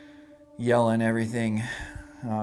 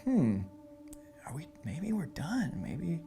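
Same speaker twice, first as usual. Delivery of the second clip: half-whispered, hopeful thought; a hushed mock whisper of relief; hushed inner hope; 5 semitones higher than usual. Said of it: "I was like".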